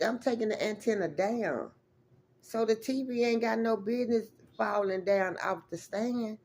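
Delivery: normal talking voice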